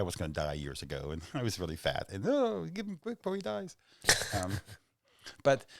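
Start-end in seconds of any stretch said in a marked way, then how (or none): 2.3-3.7 high-pitched mock urgency; much higher, hurried mock plea; panicked award giver. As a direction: squeal it like a flustered committee member.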